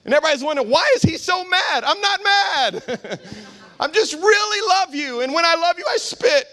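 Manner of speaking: high-pitched, impassioned preacher; fired-up preaching. Real, not acted. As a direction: preach it high and intense, half laughing at yourself.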